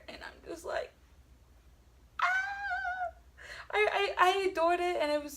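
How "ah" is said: sudden high squeal of delight; excited squeal. Real, not acted. Vlogger recalling the ending, a high delighted squeal. Gushing over a happy ending.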